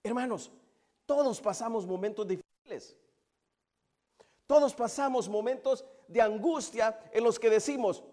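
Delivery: impassioned pulpit delivery; fervent preaching. Real, not acted. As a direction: press it on the congregation. Preaching at full force.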